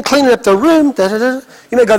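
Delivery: ordinary talk, nothing marked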